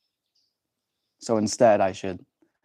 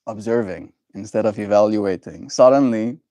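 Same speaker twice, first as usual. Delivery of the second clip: ordinary talk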